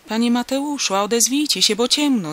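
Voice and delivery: loud, high call into the dark. A narrator's reading. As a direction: holler for a missing man to answer. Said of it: "zawołał".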